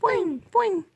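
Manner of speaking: high bouncy boing; boing it high and playful; cartoon boing. Boing game poking cat.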